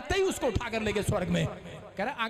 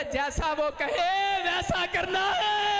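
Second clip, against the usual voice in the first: slow, high, shouted preacher call; full-force preacher shout; preaching at full force; 8 semitones higher, 5 dB louder, much slower than usual. Acted. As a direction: drive the line out slowly to the crowd. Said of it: "preaching call".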